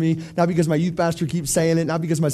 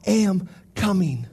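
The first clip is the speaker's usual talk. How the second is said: slow vow; much slower than usual. Pretend. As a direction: breathe it out like a solemn promise. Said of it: "Lord, listen to me".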